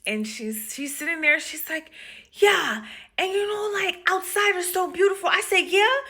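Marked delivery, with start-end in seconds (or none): 2.3-5.3 high-pitched happy chatter; animated retold enthusiasm; excited patient. Acts patient chatting happily. 5.6-6.0 high, loud rising yeah; acted encouraging enthusiasm; eager agreement. Acts her eager reply to patient.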